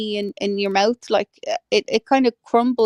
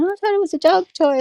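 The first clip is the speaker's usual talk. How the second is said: put-on old lady voice; 9 semitones higher, faster than usual. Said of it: "She just kept saying".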